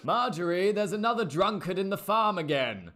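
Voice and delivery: high, loud holler to someone off; calling out. Acted. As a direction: holler it across the farm. Farmer calls out to Marjorie.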